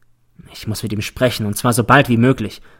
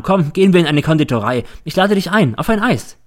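higher, coaxing invitation; friendly invitation; 6 semitones higher than usual. A narrator's reading. Teacher inviting pupil for an ice cream, friendly. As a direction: climb higher as the treat is offered.

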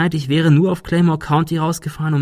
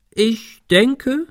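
higher, pompous opening; exaggerated; 10 semitones higher than usual. A narrator's reading. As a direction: Ben starting a mock-formal answer, hammed-up. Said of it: "fuhr er übertrieben fort".

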